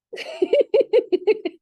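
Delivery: loud high burst of laughter; bright loud cackle of real laughter. Real, not acted. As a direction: break out laughing. Laugh heard, far, high.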